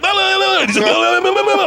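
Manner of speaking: high, fast la-la chatter; fast babble. Retold or imitated. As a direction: babble on quickly and nervously. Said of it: "talking, like, really fast".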